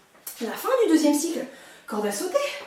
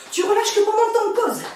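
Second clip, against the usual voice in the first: loud; 7 semitones higher, 7 dB louder than usual. Real, not acted. Coach ordering class.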